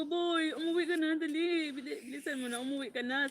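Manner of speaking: high, pleading mock cry through laughter; half-laughing mock sob. To her brother on the phone, a mock tearful plea. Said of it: "panay yung iyak ko".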